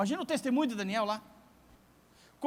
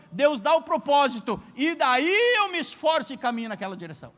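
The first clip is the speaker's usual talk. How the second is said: loud, rising pulpit preaching; fiery sermon declaration; full-force preaching; 3 semitones higher, 6 dB louder than usual. Acted. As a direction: preach it out to the whole church.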